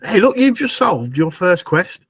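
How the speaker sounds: usual speaking voice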